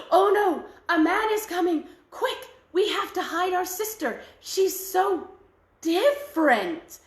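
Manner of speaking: high, loud frightened cry of alarm; hammed-up panic, slowing on different; panicked sisters. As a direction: gasp it out as if a stranger is coming. Sisters panic at approaching man.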